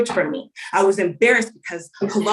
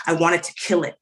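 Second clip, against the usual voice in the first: ordinary talk, nothing marked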